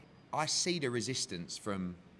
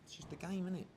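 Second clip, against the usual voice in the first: higher, breezy mock-indifferent voice; mock casual shrug; 10 semitones higher, 9 dB quieter than usual. Other people shrugging off a loss, mock casual. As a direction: imitate someone who just doesn't care. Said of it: "Whereas other people might go".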